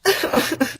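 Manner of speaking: loud airy burst of laughter; a voiceless burst of laughter; breathy laugh. Real, not acted. Laughing at insult.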